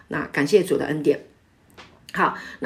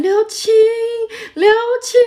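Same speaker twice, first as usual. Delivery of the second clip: high, long call, half sung; calling a lost child; 6 dB louder than usual. Retold or imitated. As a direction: call the lost child's name out across a crowded market. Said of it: "一直喊".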